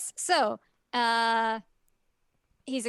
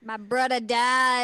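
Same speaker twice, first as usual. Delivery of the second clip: mock tragic; 2 semitones higher, 5 dB louder, much slower than usual. Echoes the line in a voice.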